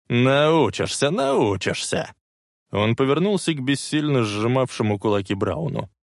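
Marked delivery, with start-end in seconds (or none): 0.1-2.1 mocking. Biff cackling, mocking Clara.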